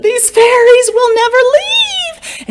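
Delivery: high, loud, slow wail; despairing old woman. A narrator's reading. Poor old woman wails that fairies won't leave, high and loud. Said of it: "The poor old woman said".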